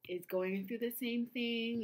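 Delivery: ordinary delivery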